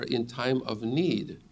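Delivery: "in time of need" spoken slowly and softly, each word weighted; slow stressed phrase. Shown as a demonstration. Preacher pointing his congregation to the key words, slow and soft. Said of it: "Notice the emphasis there".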